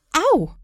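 loud, high "ow" yelp; cry of pain. Acted. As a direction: yelp after a sudden bump. Mother bumps into a chair. (lauter Schmerzensschrei)

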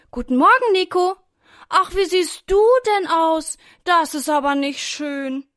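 high, loud exclaiming little-sister voice; sister's greeting. A narrator's reading. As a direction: greet him brightly, then fuss over his looks. Sister greets bitten brother, acted.

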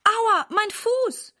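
high, slow, drawn-out whimper of pain. A narrator's reading. Mama clutches her foot, slow high pained whimper. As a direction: stretch out the hurt like a child's complaint. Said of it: "verzieht ihr Gesicht".

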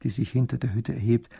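ordinary delivery

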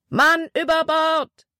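high, slow alarm call; shouted alarm. A narrator's reading. A deckhand raises the alarm on the War Eagle, shouting. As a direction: call it out across the deck. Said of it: "wurde auf der War Eagle geschrien".

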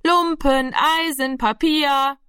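high, drawn-out rag collector's cry; singsong scrap collector's call; street call. A narrator's reading. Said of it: "dazu rufen würde".

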